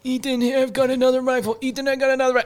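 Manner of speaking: high, loud eager call across the range. Retold or imitated. Guy calling to Ethan on the range, high and loud. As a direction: yell it up high to get Ethan's attention.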